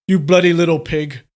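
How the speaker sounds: loud, angry outburst; loud yell. A narrator's reading. Father loses his calm at son, angry yell. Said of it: "he yelled loudly".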